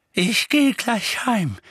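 high, slow, drawn-out voice; old woman. Acted. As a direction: answer as a harried old lady. Figg answers in her character voice.

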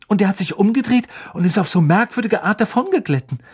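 high, fast tumbling words; excited retelling. A narrator's reading. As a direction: rush it out, still rattled. Ron excitedly recounts the attack.

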